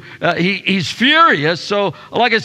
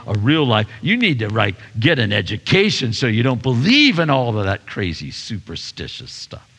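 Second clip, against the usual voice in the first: scoffing mockery; 10 semitones lower than usual.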